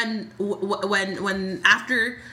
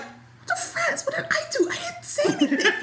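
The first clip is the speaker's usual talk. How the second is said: offended squeal. Retold or imitated. Telling a friend how offended Jason looked, in a high squeal. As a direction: play Jason as wounded and baffled, in a high laughing squeak. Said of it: "throws his arm out like".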